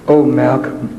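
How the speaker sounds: low, patronizing teacher voice; condescending teacher. Retold or imitated. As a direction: talk down sweetly to the child.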